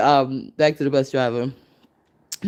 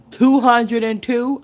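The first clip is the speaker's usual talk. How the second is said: slow, high, loud stretch on two hundred and two; drawn-out emphasis; 9 semitones higher, 4 dB louder, slower than usual. Pretend. Boasting to viewers, drawn-out loud emphasis. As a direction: lean hard on two hundred and two. Exaggerated punchline age.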